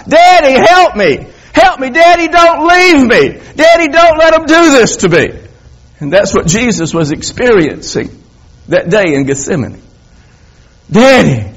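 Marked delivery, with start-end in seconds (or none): 0.1-5.3 put-on high, loud, frantic child's cry; desperate child. Boy shouting through the door after his leaving father. Son crying out behind door. 10.9-11.5 high, loud plea for daddy; child's cry. Acted. Preacher echoing the boy's cry to the congregation.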